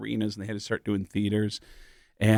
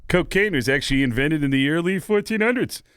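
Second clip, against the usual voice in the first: much higher know-it-all barfly voice; Cliff Clavin impression; 6 semitones higher than usual. Teen doing Cliff for party friends, high know-it-all voice. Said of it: "Cliff Clavin going".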